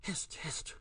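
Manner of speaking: breathy urgent hush, no voice at all; hurried husky whisper. A narrator's reading. Stranger silences Billy in a hurried husky whisper. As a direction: hiss it out in a rushed whisper. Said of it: "the hurried whisper now growing husky".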